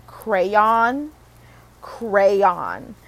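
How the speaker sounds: "crayon" slowly in two syllables, cray-on; standard pronunciation. Shown as a demonstration. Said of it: "I cannot say crayon".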